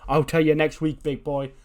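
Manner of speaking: mock shout from the ramp. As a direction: heel yelling back down at the angry wrestler. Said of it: "just shouts at him from the ramp".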